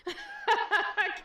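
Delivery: sudden high burst of laughter; loud laugh. Real, not acted. Big laugh at chat comment.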